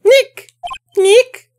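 put-on high, bright young girl calling out; Maya. Maya's high girlish voice.

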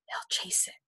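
soft conspiratorial whisper. A narrator's reading. Teacher tells children a secret, whispered.

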